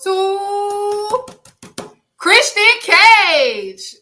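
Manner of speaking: milk the reveal like a ring announcer; big winner reveal. Acted. Host revealing heel of the year to co-hosts, drawn-out loud announcement.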